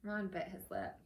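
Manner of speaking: plain reading voice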